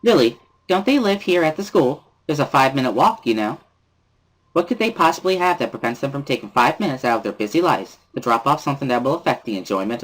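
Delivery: put-on high, bright-spirited girl pressing hard; sing-song scolding of the class rep; cheerful Misha. Misha scolding Lily about the late budget, high and chirpy.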